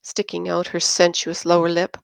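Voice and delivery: ordinary delivery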